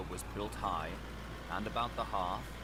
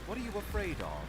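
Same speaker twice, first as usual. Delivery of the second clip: lawyer; 10 semitones higher than usual. A narrator's reading. Lawyer presses the butler.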